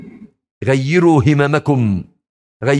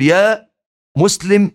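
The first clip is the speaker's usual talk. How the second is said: high call of the stone; 7 semitones higher, 3 dB louder, slower than usual. Retold or imitated. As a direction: call the believer from afar. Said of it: "الحجر والشجر ينادي على المسلم". (نداء الحجر للمسلم بصوت عالٍ)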